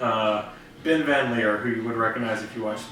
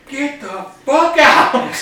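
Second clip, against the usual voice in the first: loud, high, drawn-out order; mock angry shout; 13 semitones higher, 9 dB louder, slower than usual. Government guys throwing the gamers out, mock outraged shout. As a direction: stretch each word into a comic yell. Acted officials throwing the team out.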